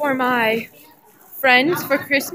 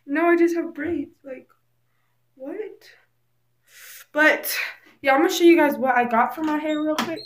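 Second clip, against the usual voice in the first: ordinary talk, nothing marked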